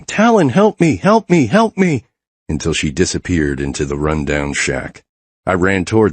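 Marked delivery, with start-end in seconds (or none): 0.0-2.0 high, loud, terrified pleading scream; a child screaming as she's dragged away; desperate high cries of help me. A narrator's reading. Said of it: "all the while she was screaming".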